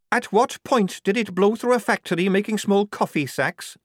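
high, loud, sceptical challenge; sceptical soldier. Acted. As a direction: pick the theory apart, high and loud.

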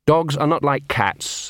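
plain reading voice

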